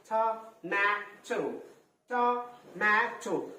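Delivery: slow "টমেটো" in three spaced beats; split into syllables. Shown as a demonstration. Teacher spelling for pupils, "টমেটো" slowly syllable by syllable. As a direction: hold each syllable of "টমেটো" apart, to, ma, to. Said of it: "three syllables".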